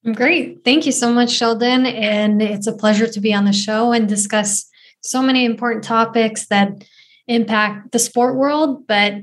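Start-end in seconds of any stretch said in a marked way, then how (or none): none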